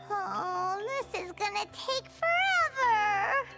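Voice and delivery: drawn-out whiny groan of complaint; whining. Acted. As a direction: moan about the endless chore. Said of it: "Less whining".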